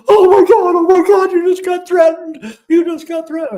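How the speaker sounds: high, loud mock wail, imitating a distraught wife; tearful panic. Ex-wife to husband after overhearing a threat, tearful mock panic. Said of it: "she was in tears, just completely in tears".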